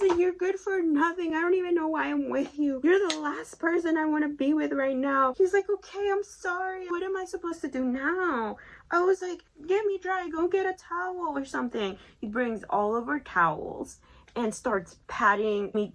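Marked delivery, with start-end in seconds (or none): 0.2-5.3 high-pitched retold tirade; hammed-up angry rant, high voice; mad. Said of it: "I was mad". 5.7-8.6 high-pitched mock pleading; retold high, helpless whine; helpless apology. Partner's helpless apology, acted. 9.7-12.0 high-pitched retold ordering; mock cross demand, pitched way up; mad. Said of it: "really mad at this point".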